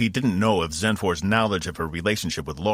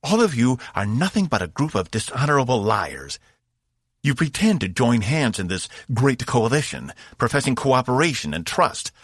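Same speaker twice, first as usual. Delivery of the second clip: raised, seething alien warrior; 6 semitones higher, 4 dB louder than usual. A narrator's reading. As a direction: accuse them with contempt. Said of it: "Zenfor seethed".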